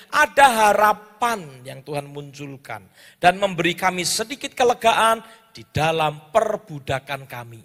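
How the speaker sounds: ordinary delivery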